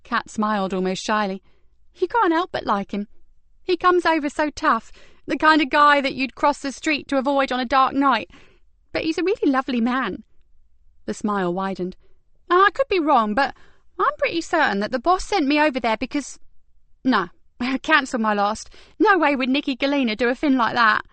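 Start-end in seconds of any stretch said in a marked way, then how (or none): 2.0-10.2 put-on lightly higher young woman voice; brisk higher detective voice; Kat voice. A narrator's reading. Kat speaking shyly of Ben. 12.5-21.0 put-on lightly higher young woman voice; brisk higher detective voice; Kat voice. A narrator's reading. Kat catching herself.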